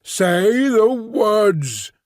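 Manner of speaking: very slow, high, commanding creature voice. A narrator's reading. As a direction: stretch each word as a menacing order. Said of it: "repeated in a louder tone".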